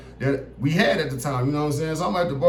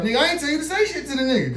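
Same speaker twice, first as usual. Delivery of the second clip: quick high-pitched scold; fast high scolding; 10 semitones higher, faster than usual. Retold or imitated. Scolding friend for talking to cops: fast high voice. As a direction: rattle it off in a high voice.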